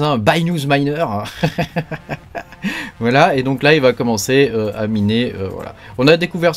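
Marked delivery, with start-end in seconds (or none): 0.2-1.0 mock-English accent, pitched up a little; French speaker putting on English; playful English accent on "bye-nooz miner"; English pronunciation. Shown as a demonstration. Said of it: "à l'anglaise". 1.1-2.4 quiet amused chuckle; soft real laugh. Real, not acted. Laughs at own English pronunciation.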